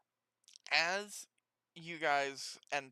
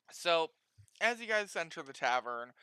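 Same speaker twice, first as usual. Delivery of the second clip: ordinary talk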